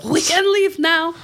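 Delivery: high, drawn-out announcement; high-pitched, slow crowd-settling call; hyped-up host. Retold or imitated. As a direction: stretch it out to a rowdy crowd.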